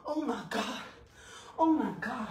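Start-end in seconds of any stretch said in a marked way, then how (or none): none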